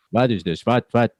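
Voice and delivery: put-on Yiddish-flavored New York accent.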